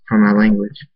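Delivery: ordinary delivery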